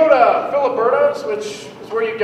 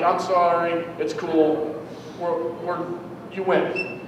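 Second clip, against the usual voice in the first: meek; 5 semitones lower, 7 dB quieter, slower than usual. Retold or imitated. Backing down meekly.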